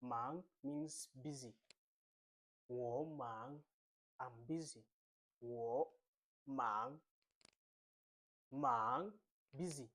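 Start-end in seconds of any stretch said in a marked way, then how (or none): 2.7-3.6 WO MANG with MANG rising; second tone. Shown as a demonstration. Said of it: "this is the second tone". 5.4-6.9 WO MANG with MANG rising; second tone. Shown as a demonstration. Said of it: "this is the second tone". 8.5-9.1 Mandarin second tone on MANG, drawn out upward. Shown as a demonstration. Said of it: "this is the second tone".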